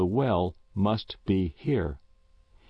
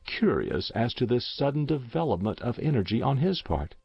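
plain reading voice

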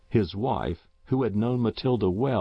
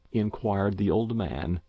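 usual speaking voice

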